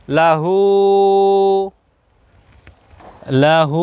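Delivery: "লাহু" with brief la, long hoo; short then long. Shown as a demonstration. Teacher joining both sounds for learners, short-long "লাহু". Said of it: "দুইটা মিলে কেমন হলো?".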